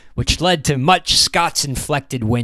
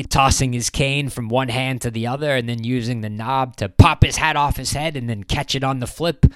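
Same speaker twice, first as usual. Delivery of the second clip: usual speaking voice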